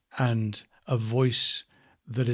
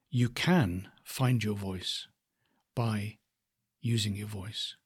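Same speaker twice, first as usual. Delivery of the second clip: "can" lifted and lengthened, with pauses around "by"; stress on can; 2 semitones lower, 3 dB quieter, slower than usual. Shown as a demonstration. Coach moves the stress along the phrase, stressing "can". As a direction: hit "can", then slow and separate the rest. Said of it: "the stress then comes into the different words".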